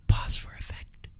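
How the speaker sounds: a mistaken aside, whispered off mic; whispered stage note. Real, not acted. Host whispering the script cue by mistake. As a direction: read the cue under the breath in a whisper. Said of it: "I'm not supposed to read pause for effect".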